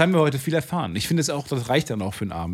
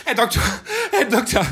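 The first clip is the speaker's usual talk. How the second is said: panicked calling; 12 semitones higher than usual. Acted. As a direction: call for help in panic. Panicked call to doctor.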